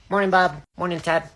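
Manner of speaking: ordinary talk, nothing marked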